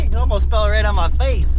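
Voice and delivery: normal talking voice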